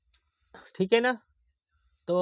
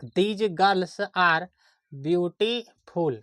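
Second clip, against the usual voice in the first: dictating slowly; much slower than usual. Shown as a demonstration. Teacher dictates the English sentence.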